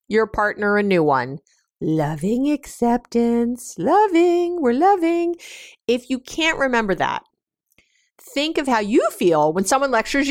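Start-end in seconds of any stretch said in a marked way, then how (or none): 1.8-5.4 high, drawn-out mock-loving mantra; slow, then rising into a high sing-song; forced lovingness. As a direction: chant it sweetly as a self-reminder, voice pitched up. Chanting a reminder to stay loving.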